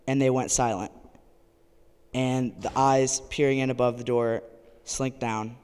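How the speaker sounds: ordinary talk, nothing marked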